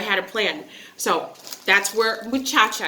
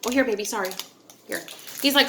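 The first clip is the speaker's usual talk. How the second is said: ordinary talk, nothing marked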